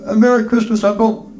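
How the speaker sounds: high-pitched festive nephew; cheerful nephew. Acted. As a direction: call the greeting in a high character voice. Nephew wishes uncle well.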